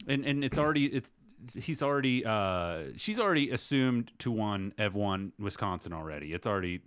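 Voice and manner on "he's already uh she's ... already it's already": usual speaking voice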